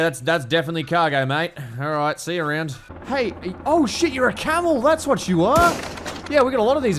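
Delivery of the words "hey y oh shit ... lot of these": high-pitched, rising to loud exclamation; excited discovery. Real, not acted. Player spotting a camel in the game, excited exclamation. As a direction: blurt it out high in amazement.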